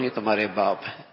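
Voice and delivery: quick, low statement, باپ unstressed; simply inform, تمہارے باپ low and even. Shown as a demonstration. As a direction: pass on تمہارے باپ as quiet, quick news. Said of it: "بچے کو خبر".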